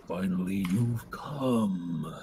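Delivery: a low, ominous voice from the cloud; lightly voiced menacing villain; dark lord voice. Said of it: "cloud says".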